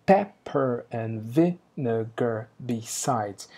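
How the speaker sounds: "Pepper and vinegar besides" said very slowly, with a heavy beat on "Pepper" and each stress pulled apart; halting rhythm. Shown as a demonstration. Teacher showing the class the broken metre, slow halting stresses. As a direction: stumble deliberately through "Pepper and vinegar besides", one beat at a time. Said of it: "É um verso todo quebradinho".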